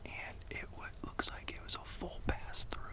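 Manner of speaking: hushed hunting whisper. Real, not acted. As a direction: whisper so the elk won't hear. Hunter whispering in the field.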